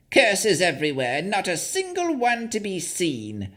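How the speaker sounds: high, mad crone voice; raving hag. Acted. The hag slumped over the lectern, high raving crone voice. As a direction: rave it like a lunatic old witch. Hag raves about curses.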